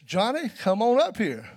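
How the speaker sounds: put-on slow, loud call up to the boy. Father calling son on bridge.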